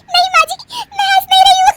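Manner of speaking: very high, loud squeal into cackles; laughing denial. Acted. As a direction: deny it while helplessly laughing. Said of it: "अरे फिर से हंस रही हो". (हँसते-हँसते इनकार करना)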